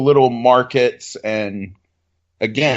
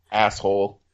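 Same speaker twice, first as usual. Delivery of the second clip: usual speaking voice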